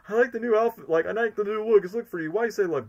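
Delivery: high, pleading mock flattery; Eggman. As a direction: lightly voice a wheedling villain, pitched high. Acts out Eggman's cutscene line.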